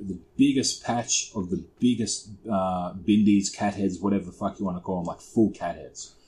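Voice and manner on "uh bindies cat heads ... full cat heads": usual speaking voice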